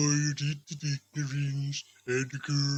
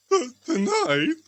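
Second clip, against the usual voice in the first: alarmed question; 10 semitones higher, 6 dB louder, slower than usual. Acted. Scrooge alarmed at the news.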